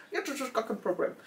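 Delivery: light imitation of an Indian accent in German. Indian hotline agent reassuring her, light Indian accent. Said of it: "ein kleiner Inder".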